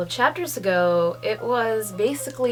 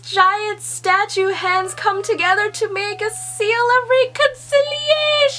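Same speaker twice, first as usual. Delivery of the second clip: rising, louder burst of delight; getting more and more thrilled toward the last word; excited; 11 semitones higher, 5 dB louder than usual. Real, not acted. Thrilled reaction to the manga page.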